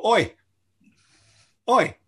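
high, punchy Cockney "oi"; Cockney attention call. Shown as a demonstration. Shows the audience how Cockneys get attention, Cockney calling out. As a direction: demonstrate a Cockney hail. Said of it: "that's how Cockneys get your attention".

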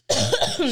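choking cough; sputtering cough as it goes down wrong; coughing up water. Real, not acted. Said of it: "[name] choked on her own question".

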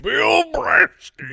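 high, drawn-out booming shout; comic shout. Pretend. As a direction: bellow it like a legend's name. Shouting comic legend name.

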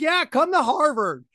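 high, bright mock welcome; mock eager invitation. Mocking Harvard welcoming a rejected student, high and eager. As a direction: sell it like a too-keen admissions office.

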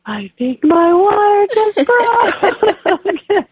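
very high, drawn-out mock wail; retold shock. Telling how her water broke, high slow squeal. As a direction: squeak it out slowly, mock-tearful. Acts out her startled reaction.

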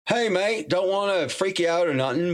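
imitating an Australian mate, voice pitched well up; a jokey Australian "hey mate" impression of a friend; Australian accent. Retelling Jed's phone call in a put-on Australian accent. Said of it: "that sounded actually Australian".

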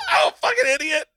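laughing. Real, not acted. Laughing at his own flub.

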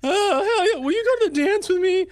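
put-on high squeaky nervous teen; a cracking kid asking a girl to the dance; asking someone out.

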